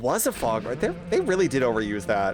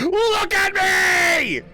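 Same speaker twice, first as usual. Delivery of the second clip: much higher, louder and very slow, a drawn-out cry; mock creepy yell; 6 dB louder, much slower than usual. Streamer mimicking the creepy stranger's call with a slow mock yell. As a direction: stretch it out like the eerie stranger calling.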